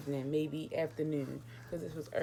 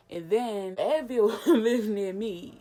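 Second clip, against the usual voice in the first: slow, loud, laughing through it; stretched-out laughing aside; 5 semitones higher, 10 dB louder, much slower than usual. Real, not acted. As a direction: crack up while saying it. Laughs mid-sentence.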